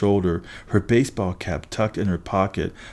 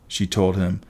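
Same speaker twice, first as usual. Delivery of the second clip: plain reading voice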